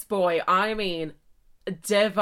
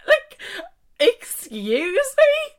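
high, loud, drawn-out squawk of disbelief; slow, high-pitched indignant question; shocked disbelief; 12 semitones higher, 7 dB louder, much slower than usual. Retold or imitated. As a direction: stretch it out, mock-scandalised. Outraged reaction to his odd line.

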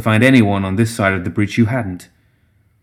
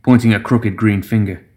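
plain reading voice

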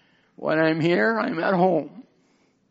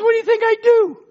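indignant retort; 9 semitones higher, 5 dB louder than usual. Retold or imitated. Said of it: "I said".

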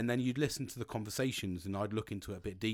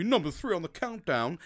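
mock announcer voice, higher and louder; countdown announcer; 6 semitones higher, 4 dB louder than usual. Host introducing number three, mock announcer voice. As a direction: boom it out like a chart show host.

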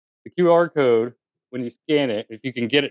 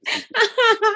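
laughing; slower than usual. Real, not acted.

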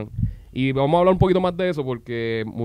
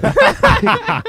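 high burst of laughter; 7 dB louder than usual. Real, not acted. Laughs at own swearing.

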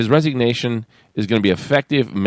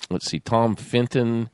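ordinary talk

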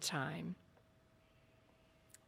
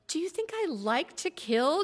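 a higher, pushed demand; 9 semitones higher, 5 dB louder than usual. A narrator's reading. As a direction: fire the question back at him. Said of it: "demanded Balthazar".

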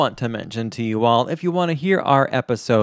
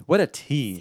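ordinary talk, nothing marked

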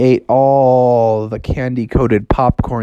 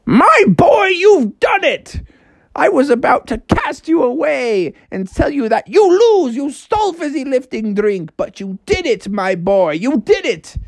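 jubilant talking house; 11 semitones higher than usual. Acted. House awards Froggy the prize, high and jubilant. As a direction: exclaim grandly, pitched well up. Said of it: "The guy was like".

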